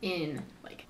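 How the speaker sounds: breathy whispered aside. Real, not acted. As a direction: drop to a whisper for this.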